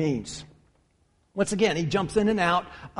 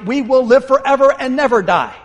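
higher and louder, driving the promise home; preaching climax; 5 semitones higher, 8 dB louder than usual. Real, not acted. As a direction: lift it like a full-voiced altar call. Preacher's climax on eternal life.

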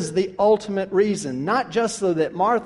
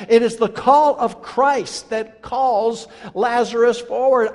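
ordinary delivery

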